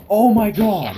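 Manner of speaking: loud, high shocked yell; surprised shout. Real, not acted. Headphone case lands in cup.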